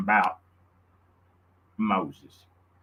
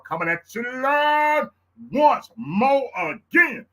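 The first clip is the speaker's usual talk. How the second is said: excited announcer; 14 semitones higher, 4 dB louder, slower than usual. Acted. Announcing his live show.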